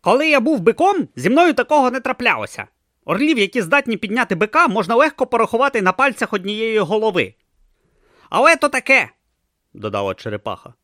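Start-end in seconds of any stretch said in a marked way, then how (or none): 0.0-9.1 high and loud, indignant; grumpy tortoise god. Acted. Tortoise god to Brutha about eagles dropping him, high indignant. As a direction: complain about eagles like an offended deity. Om voice held.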